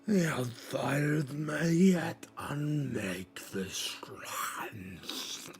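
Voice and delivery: very slow, half-whispered alchemist; bubbling Plorvik. Acted. Plorvik muses on chaos to the council, slow half whisper. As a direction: hiss the words out slowly. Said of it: "Plorvik speaks up".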